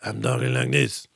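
low, soft, unmusical gruff impression; Van Damme. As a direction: drop the voice and drain out the melody. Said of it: "there's no music in his voice".

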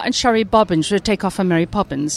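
usual speaking voice

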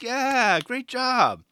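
high, loud cheer of approval; imitating an enthusiastic shout; shouted praise. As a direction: call it out to a skater across the lot. Acts out worker cheering the skater.

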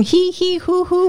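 high, breathy he-he who-who puffs; mock Lamaze breathing. Mom guessing at labor breathing, mock puffs high. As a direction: pant it like a hammed-up birthing class.

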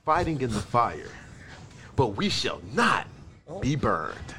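slow, low, solemn recital; dramatic reading. Acted. Host reading August's post to friends as a slow, solemn recital. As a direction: draw each phrase out low and soft, with weighty pauses.

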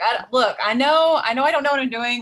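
high, loud, retold protest; flustered mock defence. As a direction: defend yourself to the kids, flustered. Acting her flustered reply to girls.

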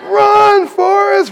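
high shrieked Run Forrest; imitating the wife's high yell; mock panicked yell. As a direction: squeal it in fake panic. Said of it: "she goes".

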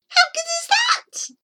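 very high, gleeful boast; excited boast. A narrator's reading. Child brags about the hiccup doll, high and excited. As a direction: show off, pitched right up.

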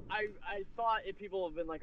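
ordinary talk

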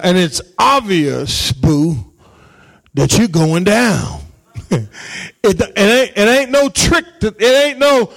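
ordinary talk, nothing marked

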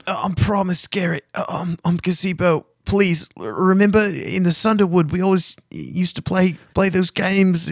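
high, rambling desperate prisoner; pleading captive. Acted. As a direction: plead to be recognised. Gazebo pleading to be believed.

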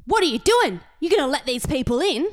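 loud, high mock-angry confrontation; loud high imitation of an old man's aggressive challenge. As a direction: impersonate an irate neighbour. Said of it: "really aggressive".

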